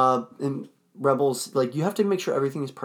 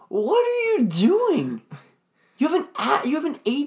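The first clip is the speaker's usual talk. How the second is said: exasperated; 11 semitones higher, 3 dB louder than usual. Acted. Exasperated at Ewok attack.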